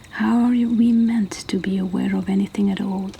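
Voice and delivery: ordinary delivery, nothing marked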